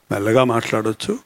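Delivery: very low, hushed voice; speaking softly. Shown as a demonstration. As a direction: turn the volume right down.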